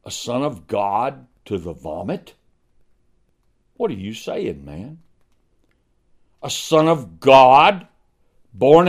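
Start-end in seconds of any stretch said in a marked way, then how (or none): 3.8-5.0 soft rebuke. Acted. Preacher repeats challenge softly.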